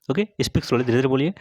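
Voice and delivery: ordinary talk, nothing marked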